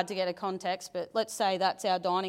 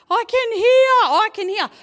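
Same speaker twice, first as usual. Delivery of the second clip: retold elated shout, pitched way up; excited cry; 12 semitones higher, 11 dB louder than usual. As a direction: cry it out loud in amazed joy. Retelling son's joyful cry at healing.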